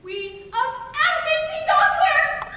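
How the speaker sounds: high, loud and slow eureka; a sudden gleeful find; excited discovery. Acted. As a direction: pounce on the discovery. Puck spotting his target.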